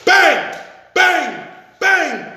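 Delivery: loud high bang bang bang; shouted gunshots. Retold or imitated. Gunshots ring out while he runs, shouted loud bangs. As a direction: punch out each bang like a gunshot. Gunshots fired during chase.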